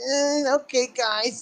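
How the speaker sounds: high-pitched, reluctant pushover voice; squeaky, hesitant going-along; meek Marty impression. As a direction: imitate a pushover giving in, very high. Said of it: "he's still like".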